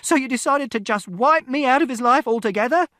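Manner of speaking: very high, loud, accusing yell; furious shout. A narrator's reading. Mother to father, furious accusing shout. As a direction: demand an answer at full volume. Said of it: "And Mother shouted".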